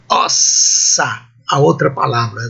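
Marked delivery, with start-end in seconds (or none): 0.1-1.3 Ossa with a long hissed double s; "Ossa" stretched across two s sounds; double s pronounced. Shown as a demonstration. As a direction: say "Ossa" as OS-sa, both s's held. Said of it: "ambos pronunciados".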